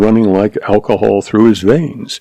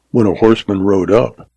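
usual speaking voice

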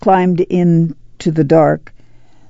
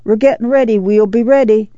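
raised-pitch call back; 5 semitones higher than usual. A narrator's reading. Neighbor answers from the other end of the car, calling. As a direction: call over to the neighbor, lightly projected. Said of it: "came the call".

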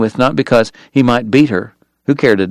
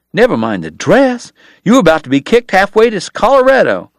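exasperated; 8 semitones higher than usual. Acted. Doc snapping back at Kate.

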